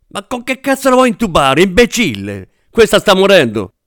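high, desperate, exasperated yell; furious shout. A narrator's reading. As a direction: scream at the idiot, someone is dying. Doctor furious at actor during real emergency. (urlo acuto, furioso e disperato)

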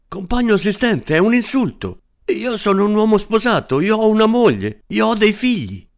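high, insistent protest; a small married man defending his honour; indignant little man. Acted. Little man protesting to the assistant, high and indignant.

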